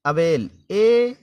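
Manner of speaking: "अवेल, ए" said slowly and loud, leaning on "ए"; teacher sounding out "अवेल" slowly and loudly; stretched demo word. Shown as a demonstration. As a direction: draw out "अवेल" then stress "ए" alone. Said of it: "second देखें".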